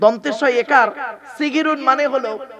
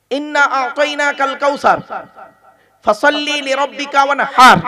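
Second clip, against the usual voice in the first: Quran recitation; 3 semitones higher, 6 dB louder than usual. Shown as a demonstration. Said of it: "বা বলুন না একটু".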